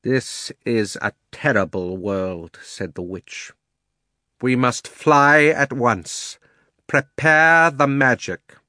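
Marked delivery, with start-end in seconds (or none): 0.0-2.6 slow, deliberate witch voice; lightly voiced witch, each word drawn out; imperious witch. Witch condemns the new world. 4.4-8.6 loud, high, very slow command; imperious witch drawing out each order. Acted.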